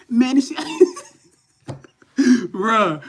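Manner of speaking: slow words broken by a real laugh; amused groan that dissolves into laughter. Real, not acted. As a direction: laugh through the words, a touch high. Laughing at his own frustration.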